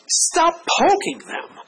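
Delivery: stretched high, slow stop poking them; emphatic plea. Acted. Pastor pleading with parents, slow and emphatic. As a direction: lean hard on poking, slowly.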